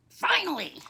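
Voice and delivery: put-on exasperated old-man voice; grouchy old man. Old man reaching the cafe, exasperated. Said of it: "the old man says".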